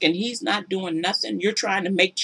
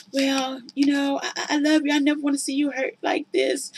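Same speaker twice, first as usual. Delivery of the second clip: soft mocking sweet-talk; 8 semitones higher, 4 dB quieter than usual. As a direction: mimic his honeyed excuses. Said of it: "his soothing reply".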